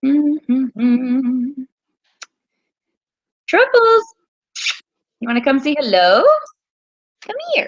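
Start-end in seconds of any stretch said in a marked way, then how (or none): 3.5-4.0 calling the dog up high and loud. Real, not acted. To her dog Truffles, a high, loud call. 7.2-7.7 very high coaxing call; coaxing the dog. Real, not acted. Coaxing her dog to come say hello, very high.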